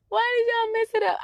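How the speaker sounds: high, pained cry of frustration; exasperated plea. Retold or imitated. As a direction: wail it like you can't believe they blew it. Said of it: "I used to get so emotional".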